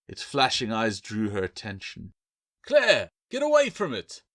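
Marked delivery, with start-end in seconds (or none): 2.6-4.2 much higher, louder warning shout. A narrator's reading. Bill warning Claire from behind the door, shout. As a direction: yell the warning through the door. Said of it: "the man warned".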